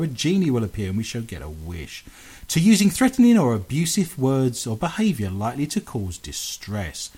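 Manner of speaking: ordinary delivery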